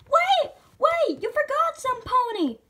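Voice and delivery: put-on high, loud kid outcry; urgent interruption. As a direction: burst in to stop them. Pony urgently interrupts the host.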